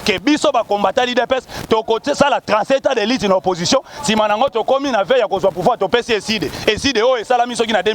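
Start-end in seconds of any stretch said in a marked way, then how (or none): none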